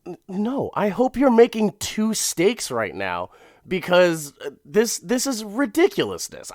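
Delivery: high, loud pretend protest; indignant. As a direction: play yourself outraged over a stolen steak. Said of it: "I'd be like".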